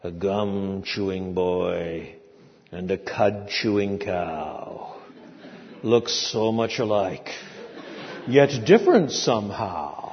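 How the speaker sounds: slow, lightly mocking recitation; a hint of a stern teacher reciting; recited rhyme. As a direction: intone the old teacher's rhyme slowly. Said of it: "and he'd recite".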